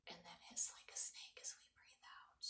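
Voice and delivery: a faint breathy whisper. Real, not acted. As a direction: barely breathe the words out. Guiding kids through snake breath.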